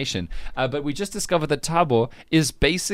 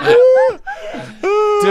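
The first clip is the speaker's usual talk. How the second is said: laughing; 8 dB louder than usual. Real, not acted. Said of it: "urging through laughter".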